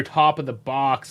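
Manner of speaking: ordinary delivery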